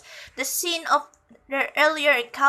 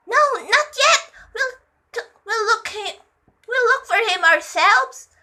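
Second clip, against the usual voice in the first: high-pitched, louder urgent voice; urgent character reply; 9 semitones higher, 5 dB louder than usual. A narrator's reading. Character urgently answers in story.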